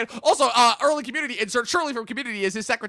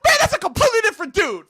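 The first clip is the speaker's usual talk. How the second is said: high, fast mock outburst through laughter; shouted laughing quote; 11 semitones higher, 4 dB louder, faster than usual. Friend retelling a movie line, shouting and laughing. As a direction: blurt it out, cracking up. Quoting Tropic Thunder character.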